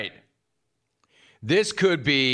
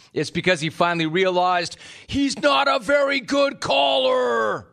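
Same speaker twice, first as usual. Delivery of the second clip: rising yell; 9 semitones higher than usual. Acted. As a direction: build to a shout on caller. Building to a yell.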